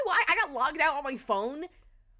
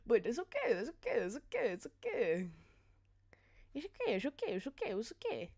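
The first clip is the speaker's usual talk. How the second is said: fading reassurance; 2 semitones lower, 8 dB quieter than usual. Real, not acted. Self-soothing repeated okays, fading.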